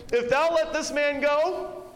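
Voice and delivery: loud, high, slow accusing cry; drawn-out mob threat, pitched high and loud; crowd's threat. Acted. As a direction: shout it down at Pilate like an angry mob. Preacher voices the crowd's threat.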